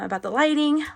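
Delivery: ordinary delivery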